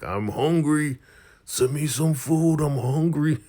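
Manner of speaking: high, loud hammed-up demand; mock angry yell. Hangry boss hollers at the door to be fed, mock angry yell. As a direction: imitate a hangry man hollering for food. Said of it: "he is just mad right now".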